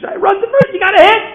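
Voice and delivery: very high, loud urgent holler; teammates' cheering yell. Retold or imitated. Teammates urge Shai to first, excited yelling. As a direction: scream him on toward the base.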